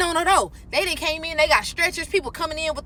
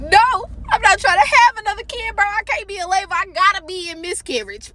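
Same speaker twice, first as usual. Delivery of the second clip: panicked denial; 6 semitones higher than usual. Retold or imitated.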